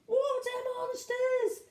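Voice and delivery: high, drawn-out mock wail; slow, squealed alarm; alarmed cry. A narrator's reading. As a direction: cry out in storybook terror. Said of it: "and one cried".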